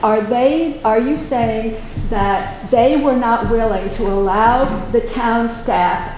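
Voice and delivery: ordinary talk, nothing marked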